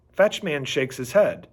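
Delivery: ordinary delivery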